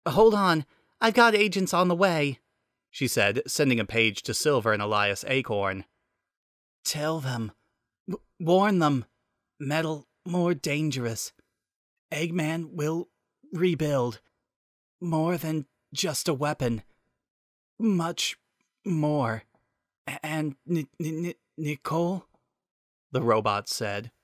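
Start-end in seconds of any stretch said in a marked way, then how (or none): 0.1-2.4 put-on urgent young woman. Urgent reply to injured agent. 6.8-22.3 fading, stuttering robot voice; weak broken voice. Acted. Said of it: "His voice died off".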